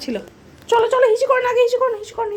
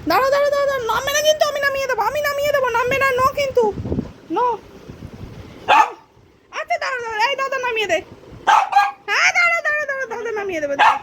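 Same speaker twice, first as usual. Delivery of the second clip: high, sing-song coaxing to the cat; baby talk to a pet; 10 semitones higher than usual. Acted. (বিড়ালকে উঁচু আদুরে গলায় থামানো)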